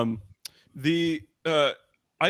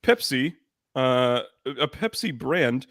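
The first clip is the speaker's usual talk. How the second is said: slow, hammed-up ad announcer voice, a bit louder and higher; mock ad read; 4 semitones higher, 3 dB louder, slower than usual. Host presenting the new soda to listeners, mock ad-read voice. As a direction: sell the soda like a TV commercial.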